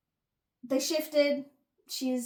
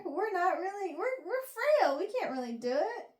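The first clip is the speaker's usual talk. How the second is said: mock frail; 7 semitones higher than usual. Mimicking fragile Omega excuse.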